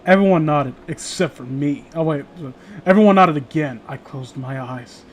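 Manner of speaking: normal talking voice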